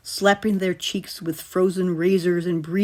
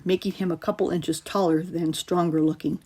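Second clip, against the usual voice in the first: plain reading voice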